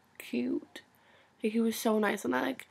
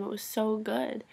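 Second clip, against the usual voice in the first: usual speaking voice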